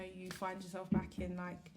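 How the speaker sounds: ordinary delivery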